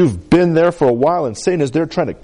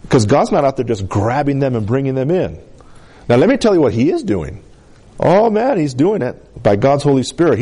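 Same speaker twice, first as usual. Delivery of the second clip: ordinary talk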